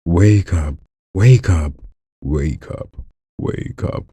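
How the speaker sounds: warm up toward the villain voice; trying out Madara. Acted. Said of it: "You don't sound like Madara".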